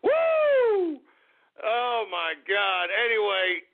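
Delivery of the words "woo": high, loud celebratory woo; whoop. Real, not acted. Host to listeners celebrating, a loud whoop. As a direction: let out a victory whoop.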